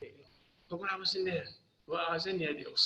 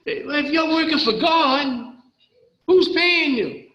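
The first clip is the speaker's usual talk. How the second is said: higher and much louder, imitating a guard; mock guard retort; 10 semitones higher, 8 dB louder, slower than usual. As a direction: throw the question back at him hard. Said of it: "He says".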